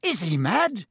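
high, aghast question; gasped. A narrator's reading. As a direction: blurt it in disbelief. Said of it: "Ron gasped".

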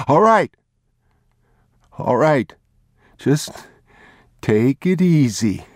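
high, loud, hurried giving in; calm him down before he shoots; a frightened thief trying to soothe the father; placating thief. Acted.

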